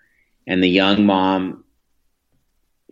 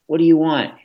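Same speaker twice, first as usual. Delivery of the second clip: more exasperated; 9 semitones higher than usual. Retold or imitated. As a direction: snap the question out as patience runs out. Exasperated parent, crying baby in car.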